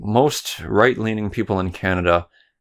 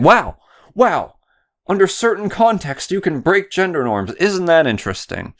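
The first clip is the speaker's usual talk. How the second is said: high, sarcastic wow, much higher; mock amazement; 10 semitones higher than usual. Mocking a pundit's logic with high sarcastic fake wonder. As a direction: gush over it in exaggerated fake wonder.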